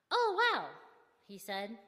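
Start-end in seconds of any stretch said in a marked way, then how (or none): 0.1-0.7 high, loud, impressed exclamation; wowed, voice jumping up; amazed. A narrator's reading. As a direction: react with delighted astonishment. Driver impressed by pastor news.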